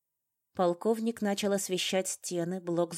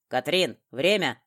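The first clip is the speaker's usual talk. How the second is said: loud warning call; sharp, loud reminder; urgent shout; 8 dB louder than usual. A narrator's reading. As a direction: shout it across to hurry them. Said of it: "выкрикнул полковник".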